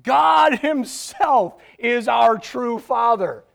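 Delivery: indignant shout. Acted. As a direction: hurl it back as a defiant mob. Crowd shouting at Jesus.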